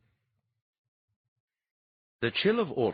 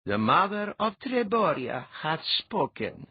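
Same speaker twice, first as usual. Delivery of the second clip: mock-solemn, much higher, slow proclamation; solemn intoning; 9 semitones higher, 4 dB louder than usual. A narrator's reading. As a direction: intone it like a herald, tongue in cheek. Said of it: "Letha intoned".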